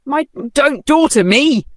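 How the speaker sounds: loud, high furious bark; angry bark. A narrator's reading. Rich woman barking at an elderly stranger. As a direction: bite her head off. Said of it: "Vanessa barked".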